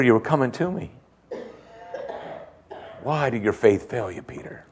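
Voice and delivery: Jesus tenderly reassuring a sinking Peter, softly; tender words. Retold or imitated. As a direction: speak it close to his ear like a kind friend. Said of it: "these words are tender ... might have whispered them".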